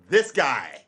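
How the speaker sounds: loud, punched boast; triumphant shout. Real, not acted. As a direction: belt out the answer, claiming credit. Triumphantly answers own question.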